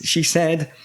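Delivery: usual speaking voice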